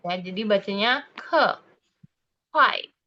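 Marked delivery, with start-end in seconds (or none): 1.2-1.5 ke said with a downward tone; falling tone. Shown as a demonstration. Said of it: "nadanya itu turun ke bawah". 2.5-2.9 Kuai high and loud, then falling. Shown as a demonstration. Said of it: "nadanya itu turun ke bawah".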